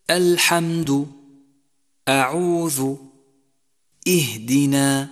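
الحمد, أعوذ, اهدنا each begun with a thin hamza; slow separate words with light opening vowels. Shown as a demonstration. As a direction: start each word thin, never heavy. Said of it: "يجب ترقيق الهمزة وعدم تفخيمها".

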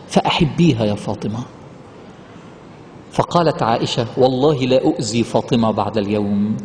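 low, slow and solemn recital; reverent hadith recitation. Acted. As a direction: recite the Prophet's words with reverence. (تلاوة حديث بخشوع وصوت منخفض)

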